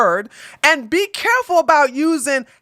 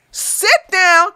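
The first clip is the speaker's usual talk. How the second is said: ordinary talk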